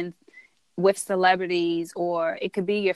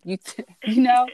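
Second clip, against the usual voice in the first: laughing; 6 semitones higher, 6 dB louder than usual. Real, not acted. Laughing mid-sentence with guest.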